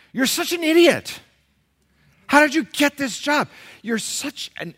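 high, very loud exasperated yelling; acted anger. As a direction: blow up at an incompetent stranger. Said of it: "becoming the object of your anger".

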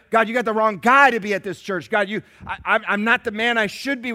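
loud, halting confession to God; self-doubting prayer. Retold or imitated. The pastor telling God he is the wrong guy, loud doubt. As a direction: stumble through a loud confession. Preacher voicing his own doubt.

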